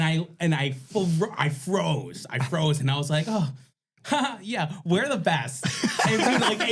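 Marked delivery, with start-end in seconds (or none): 3.3-5.7 high-pitched laughing lisp. Retold or imitated. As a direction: throw a lisp on it, camp and giggly. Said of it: "I threw the lisp on and everything".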